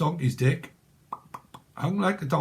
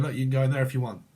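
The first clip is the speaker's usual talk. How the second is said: quick offhand quote; much faster than usual. Retold or imitated.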